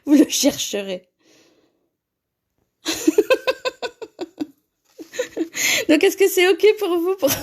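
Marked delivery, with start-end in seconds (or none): 2.8-4.4 long high giggle; high laugh. Real, not acted. Host teasing viewer Sandra, high giggle. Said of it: "Je ne lirai pas ce commentaire".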